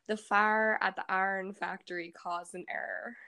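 Pittsburgh Yinzer accent, "fire" as "far". Shown as a demonstration. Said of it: "but a Yinzer would say".

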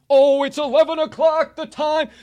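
high, loud sudden realization of the hour; surprised exclamation. Real, not acted. Host noticing the clock hit eleven on air, surprised yelp. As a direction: yelp it out, caught off guard.

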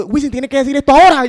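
mock concert shout; explosive call. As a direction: burst out like a hype man. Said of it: "sale como explosivo".

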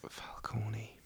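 breathy whisper of the name. Real, not acted.

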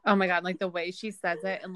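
ordinary talk, nothing marked

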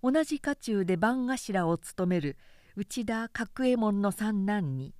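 ordinary delivery

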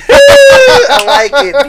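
high, loud and stretched out delighted cry; excited squeal. Real, not acted. Delighted reaction to setup.

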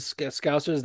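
normal talking voice

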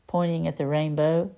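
ordinary delivery

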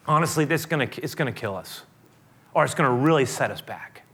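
ordinary delivery

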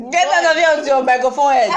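loud, fast laughing shoo-away; laughing scold. Real, not acted. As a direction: laugh while telling him off.

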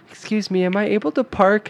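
high-pitched mock girl voice; put-on high female voice; imitated girl. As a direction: pitch it way up as a polite girl asking. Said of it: "a girl came up and was like".

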